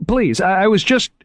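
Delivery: high, loud, tripping words; flustered stammer. A narrator's reading. Moriarty begging Margot to stay, flustered stammer. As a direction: scramble to apologise. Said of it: "he stammered".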